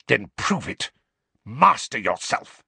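terse, mildly spat command; spitting. A narrator's reading. Snape challenges Harry to master himself, spitting the words. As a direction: spit out the orders with restraint. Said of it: "spat Snape".

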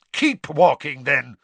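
loud curt snarl. Acted. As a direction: dismiss them harshly. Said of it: "Snape snarled".

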